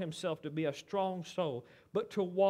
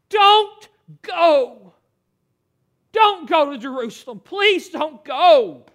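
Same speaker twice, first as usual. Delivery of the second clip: loud, desperate begging, the last plea slow; loud pleading; 7 semitones higher, 17 dB louder, slower than usual. Acted. Disciples begging Paul to stay away from Jerusalem, loud and desperate. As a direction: plead with everything you have: don't go.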